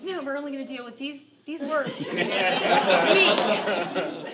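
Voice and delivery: telling her daughter the rules while laughing. Real, not acted.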